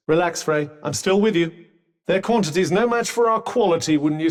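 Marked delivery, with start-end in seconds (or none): none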